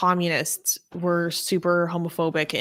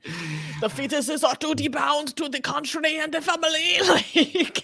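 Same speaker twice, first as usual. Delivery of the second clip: put-on German accent; 9 semitones higher than usual. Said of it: "I can't do a German accent".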